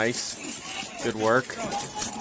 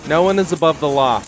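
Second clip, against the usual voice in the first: loud, fast, forceful statement; emphatic declaration; 4 semitones higher, 10 dB louder, faster than usual. Real, not acted. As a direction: hammer the point home. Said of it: "Remember that".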